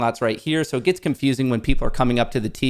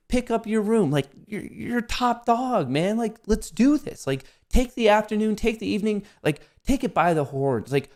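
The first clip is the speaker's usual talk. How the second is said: hyped-up, much higher pep talk to himself; self pep talk; 8 semitones higher than usual. Acted. Proud cook cheering himself on, high and hyped. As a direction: pump yourself up like your own coach. Hyping himself up after cooking.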